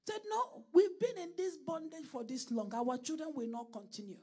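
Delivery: loud, defiant plea in a preacher's voice; impassioned preaching. Acted. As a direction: cry out on behalf of a people in bondage. Preacher voices Israelites crying for help.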